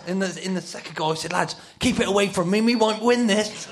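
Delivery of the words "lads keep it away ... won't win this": high, loud plea across a pitch; shouting to teammates. Retold or imitated. Player begging teammates after the second goal, shouting. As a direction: yell a comic warning to the team.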